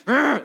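loud, high grunt of frustration; mock tantrum noise; upset child's growl. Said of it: "They get more upset".

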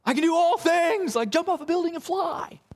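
high, cartoonish voice; a cartoon hero bragging he can fly; comic strip boast. Preacher mocking a comic strip misreading, high cartoon boast. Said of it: "The comic strip, for example".

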